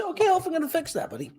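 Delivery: high pet talk. Acted. Host promising the cat a fix, high quick pet talk. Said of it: "And I'm gonna say".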